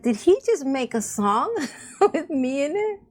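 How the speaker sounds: giggling while asking in disbelief; retelling her amused shock with a laugh. Retold or imitated. Disbelief at song with her name.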